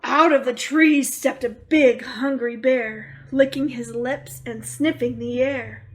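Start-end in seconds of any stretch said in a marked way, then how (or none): none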